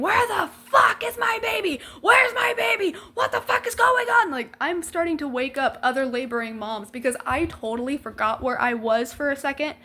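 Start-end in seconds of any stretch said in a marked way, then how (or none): 0.0-4.3 high, frantic mother's shout; screaming. Retold or imitated. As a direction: scream it high, demanding your baby back. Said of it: "running up and down the nicu screaming".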